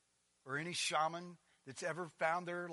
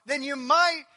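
higher, louder mock pleading voice; mocking legalist; 9 semitones higher, 16 dB louder than usual. Pastor mocking what Jesus didn't say, loud parody. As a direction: parody a fussy rule-maker. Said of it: "He says".

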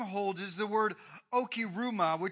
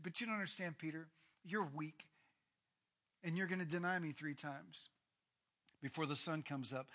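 soft, lower, gently sad; quiet sorrowful warning; quiet reply; 3 semitones lower, 9 dB quieter than usual. Acted. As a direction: tell him softly he will fail. Jesus answers Peter's boast.

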